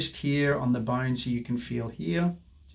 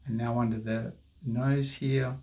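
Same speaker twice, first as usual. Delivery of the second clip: ordinary talk, nothing marked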